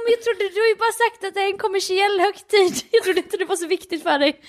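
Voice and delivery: high, imitated defensive pleading; flustered panic. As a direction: explain yourself in mild mock panic. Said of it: "han får panik".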